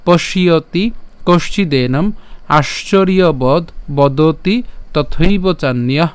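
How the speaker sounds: slow, broken-up Sanskrit chanting; broken Sanskrit verse, word by word; slow syllable-by-syllable recitation. Shown as a demonstration. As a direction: recite each word slowly and deliberately. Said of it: "প্রথম থেকে যদি আবার উচ্চারণটা করি".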